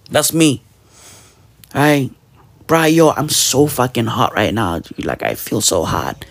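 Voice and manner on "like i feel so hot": usual speaking voice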